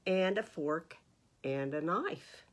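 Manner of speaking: ordinary delivery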